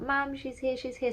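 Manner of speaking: high excited call of mum; a daughter calling out to mum. Retold or imitated. A child calling her mum from the next room, high and excited. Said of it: "she called out".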